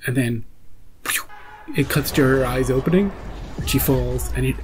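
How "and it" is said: ordinary talk, nothing marked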